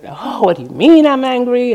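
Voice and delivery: exploding in anger. Retold or imitated. Patient exploding at the nurse who called her angry, indignant. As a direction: fire the question back in indignant anger. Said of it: "'cause she just exploded".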